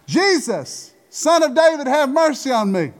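high-pitched, imploring call for mercy; crying out. Retold or imitated. Blind Bartimaeus calls to Jesus from the roadside, crying out. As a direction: call out high, pleading to be noticed. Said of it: "in Hebrew means to holler".

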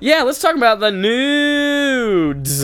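high, loud drawn-out holler; hammed-up segment intro. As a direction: stretch "nudes" out in a long high call.

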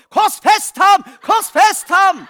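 high, very loud crowd-style cry, last words drawn out; re-enacted yell. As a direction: bellow it as if jolted out of a doze. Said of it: "Så jeg våkner i et nu og roper".

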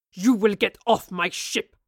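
captain threatens them off his ship; growled threat. A narrator's reading. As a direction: growl the order, menacing. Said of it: "he growled".

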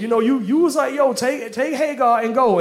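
high-pitched, rapid comic protest; mock indignant defence. Abraham defending himself to Sarah about Hagar, high indignant protest.

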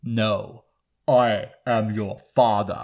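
slow, low, weighty reveal; hammed-up ominous villain voice; movie villain impression. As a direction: intone it like a dark lord. Acting the famous villain reveal.

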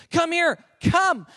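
loud, urgent stress on COME; emphatic summons. Shown as a demonstration. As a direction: COME punched high and loud. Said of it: "What he's saying is".

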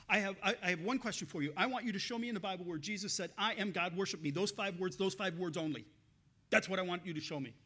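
retold angry demand, forceful and pushy; angry challenger. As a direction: hammer out the demand like a frustrated heckler. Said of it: "he was just angry".

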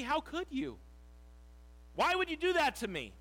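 high-pitched, indignant questioning; imagined reproach. Pretend. Preacher imagining himself abandoned by his friends, indignant reproach. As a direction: demand an answer as if betrayed. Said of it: "My thoughts might be".

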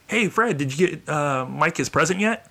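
mild producer voice, slowing at the end; lightly voiced producer.

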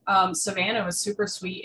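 ordinary talk, nothing marked

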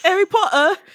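mock British accent. Joke call in British accent.

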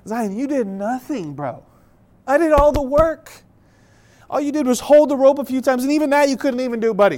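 high, loud comic protest; mock indignant dad. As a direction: act out a dad's unspoken grievance. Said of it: "In my head, I'm thinking".

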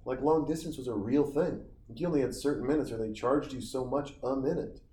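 ordinary talk, nothing marked

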